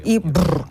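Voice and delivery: quick lip trill after a word. Shown as a demonstration. Coach repeats the lip trill warm-up, buzzing. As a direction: flutter the lips with a short brrr. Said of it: "дві хвилини варто пофиркати".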